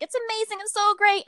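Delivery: high-pitched gushing fan imitation; raving. Reader praising the author's first book, raving. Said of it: "she raves about it".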